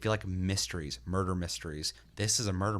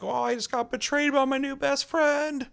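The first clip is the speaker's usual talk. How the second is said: much higher, slow whiny wail; hammed-up hurt friend voice; mock whine; 7 dB louder than usual. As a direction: ham up a betrayed sad sack. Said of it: "Don't be like".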